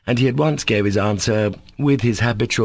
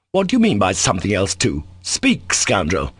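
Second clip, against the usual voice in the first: furious demand. Acted. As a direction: demand it, then spit the insult. Fist on table, demanding answer.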